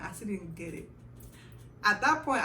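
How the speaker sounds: ordinary talk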